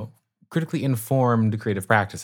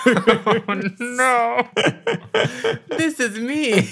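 laughing; 10 semitones higher, much slower than usual. Real, not acted. Laughing, taken aback by question.

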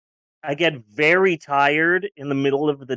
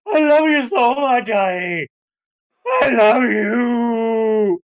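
higher, slow, gushing I love you; gushing, overly emotional; 6 semitones higher, 4 dB louder, slower than usual. Retold or imitated. On estrogen, telling wife she loves her, gushing slowly. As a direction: stretch it out like you're welling up with love. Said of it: "But now I'm on estrogen, and it's just".

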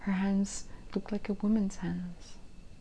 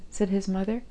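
ordinary delivery, nothing marked

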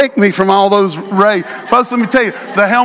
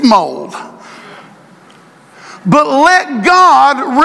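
full-force preaching; 3 semitones higher, slower than usual. Acted. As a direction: hammer each phrase home.

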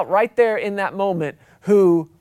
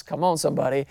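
ordinary talk

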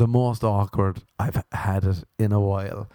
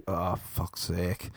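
slow, much softer groaning "fuck's sake"; exasperated grumble; 3 semitones lower, 9 dB quieter, much slower than usual. Retold or imitated. Fed up with the visitor, to himself: slow soft grumble. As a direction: sigh it out, fed up.

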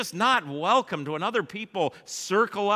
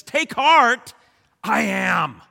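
high, loud ringing call; proclaimed; 4 semitones higher, 8 dB louder, slower than usual. Acted. Preacher voicing Jesus at the climax, loud and high. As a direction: lift it to the rafters.

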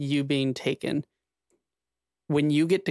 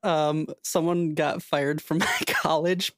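laughing through the words; struggling to speak for laughter; hysterical laugh. Real, not acted. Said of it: "Why are you laughing so hard?".

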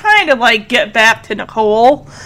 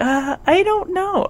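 small, soft, higher voice; imitated meek reply; timid answer; 6 semitones higher, 10 dB quieter than usual. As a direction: shrink back as you answer. Voicing Julie's timid answer.

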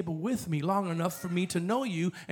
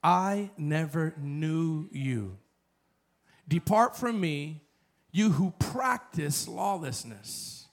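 slow, grave "I never knew you"; slow solemn reading; said slowly; 2 semitones lower, slower than usual. Shown as a demonstration. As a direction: let each word of "I never knew you" fall slowly. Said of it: "say this slowly".

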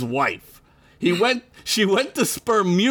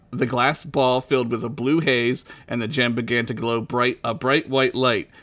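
ordinary talk

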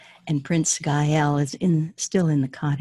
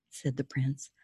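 normal talking voice

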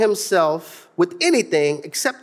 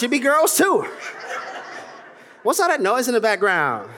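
usual speaking voice

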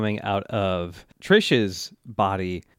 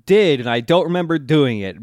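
high, loud defensive retort; acted indignant comeback; mock protest; 5 semitones higher, 4 dB louder than usual. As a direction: protest back in imitation. Retold quarrel, Mista protests back.

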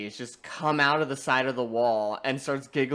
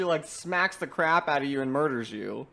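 ordinary talk

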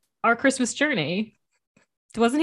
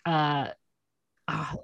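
normal talking voice

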